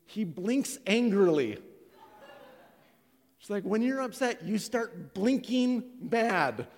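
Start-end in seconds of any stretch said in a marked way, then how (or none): 0.1-1.6 slow, loud, high announcement; wife's public revelation. Retold or imitated. Wife telling on her husband at marriage event, announcing. 3.6-6.7 high, loud explaining voice; wife telling on him. Retold or imitated. Wife explaining husband's habit before church staff, insistent.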